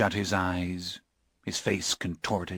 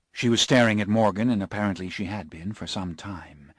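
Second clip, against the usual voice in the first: usual speaking voice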